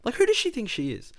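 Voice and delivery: exasperated high whine. Retold or imitated. Venting to Dykesy about the girl, high and fast. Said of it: "I'm like".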